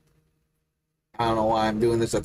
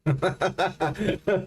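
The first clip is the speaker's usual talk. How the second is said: laughter; 7 semitones higher than usual. Real, not acted. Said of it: "Unless they're fucking frogs".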